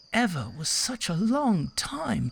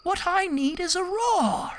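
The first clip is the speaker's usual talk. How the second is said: eager mouse; 11 semitones higher than usual. Acted. Mouse voicing his plan.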